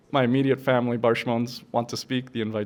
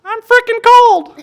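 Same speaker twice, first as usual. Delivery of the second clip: very high, loud, slow laughing cry; baby bear punchline; 17 dB louder, slower than usual. Acted. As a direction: blurt the punchline as a shivering cub. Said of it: "punchline in cub voice".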